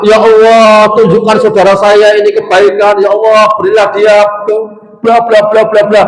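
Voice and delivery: high, loud pleading prayer; high, loud retold supplication; fervent prayer. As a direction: lift your hands and plead. Scholar prays for his attacker.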